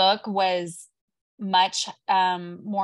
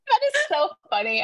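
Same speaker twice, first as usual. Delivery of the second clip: high-pitched, quick laughing remark; laugh, then talk quickly through it; tickled, voice jumping up with laughter; real amused laughing; 13 semitones higher, faster than usual. Real, not acted. Amused by the Texas mix-up.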